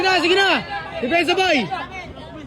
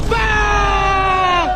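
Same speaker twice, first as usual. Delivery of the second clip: excited shout; 5 semitones higher than usual. Real, not acted. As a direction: belt it out as the ball drops. Celebrating a made shot. (masayang sigaw)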